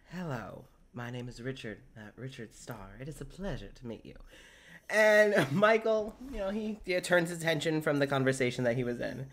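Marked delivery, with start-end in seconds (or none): 0.1-4.2 quiet, hushed formal greeting; a softer, courtly hello; soft-spoken Richard. Acted.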